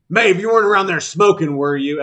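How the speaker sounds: loud, quick, high challenge; tactics teacher. Acted. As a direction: holler an accusing question at a student. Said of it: "he says".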